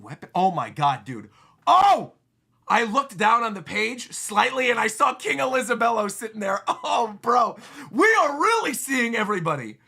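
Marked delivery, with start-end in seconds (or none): 1.7-2.1 sudden high, loud cry of surprise; surprised shout. Real, not acted. Reader spots King Elizabello on the page, surprised shout. 7.2-9.8 loud, high, thrilled exclamation; excited shout. Real, not acted. Reader sees every character at once, excited shout.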